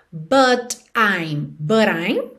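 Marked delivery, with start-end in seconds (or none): 1.6-2.3 "but I'm" run together, t flapped like a quick r; tapped t. Shown as a demonstration. Teacher showing the rararã sound, linked and tapped. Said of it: "com rararã".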